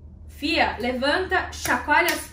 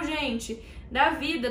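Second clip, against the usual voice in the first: ordinary delivery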